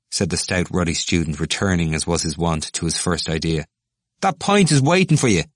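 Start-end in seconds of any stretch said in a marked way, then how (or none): 4.2-5.4 high, loud egging on; a drinker goading the speaker; hearty urging. Acted. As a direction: cheer him on. Ruddy student urging.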